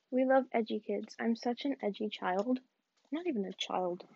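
normal talking voice

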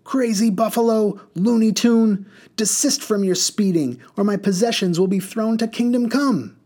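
high, loud indignant scolding; angry scolding woman. A narrator's reading. Mud woman scolding the reckless driver. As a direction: tell the driver off.

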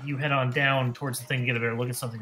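usual speaking voice

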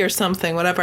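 ordinary delivery

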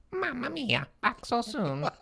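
high squeaky Italian voice; muffled high Italian old man; high-pitched Italian voice. Acted. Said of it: "high-pitched, muffled Italian voice".

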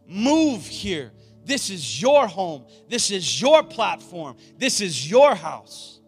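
loud, high preaching prayer; rally speech. Real, not acted. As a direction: pray it like a battle cry. Closing prayer at full force.

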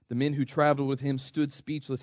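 ordinary talk, nothing marked

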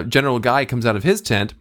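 normal talking voice

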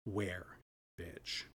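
low, deadly quiet threat; menacing whisper. Retold or imitated. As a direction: barely breathe it out. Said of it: "and she says".

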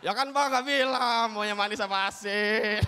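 slow, drawn-out placating voice; mock excuse. Helper explains the salty coffee to Mbah, mock excuse. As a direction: stretch it out as a slow, innocent excuse.

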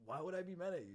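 high, loud retold disbelief; mock-defensive and incredulous; incredulous protest. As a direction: push the question up in baffled protest. Retold baffled denial of anger.

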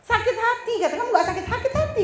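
curious high-pitched friend asking; high, surprised friend. Retold or imitated. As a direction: sound amazed she isn't hurt. Wife's friend asks, high voice.

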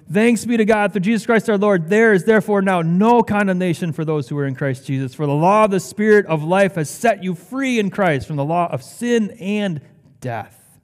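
high, loud, rolling proclamation; impassioned scripture reading. Acted. Preacher reading Paul's thanks to the church, impassioned. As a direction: declare the verse with mounting conviction. Reads scripture with raised force.

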